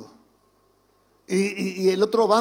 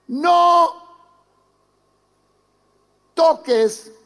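higher, louder admitting a mistake; confessing child; 5 semitones higher, 5 dB louder, slower than usual. Acted. Child admitting to his father he was wrong, higher and louder. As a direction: own up like a chastened kid. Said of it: "Está diciendo".

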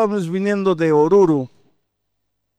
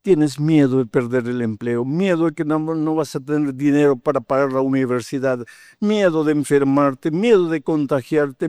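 usual speaking voice

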